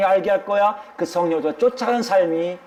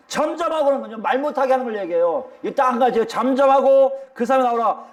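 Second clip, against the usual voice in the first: ordinary delivery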